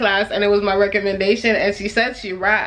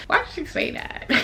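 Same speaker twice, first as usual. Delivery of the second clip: breathy disbelief; 11 semitones higher, 6 dB quieter than usual. Real, not acted. Laughing disbelief at friend.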